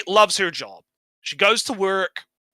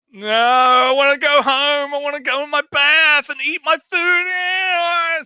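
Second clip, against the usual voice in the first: higher, slow, drawn-out whine; whiny fed-up receptionist; 8 semitones higher, 4 dB louder, slower than usual. Retold or imitated. Overworked receptionist whining about overtime, high and slow. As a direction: beg to go home, dragging every word out. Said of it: "she's getting really mad".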